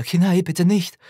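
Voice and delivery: high and loud desperate call. A narrator's reading. Mother calls her burning son, loud desperate call. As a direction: cry the child's name out in panic. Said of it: "rief ich immer wieder".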